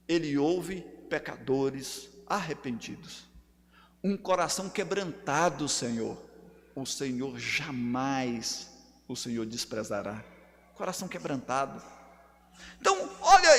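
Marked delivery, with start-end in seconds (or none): 0.1-11.8 much lower and softer; tender reassurance. Real, not acted. Preacher comforting repentant sinners, tender reassurance. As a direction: drop to a hushed, grave gentleness.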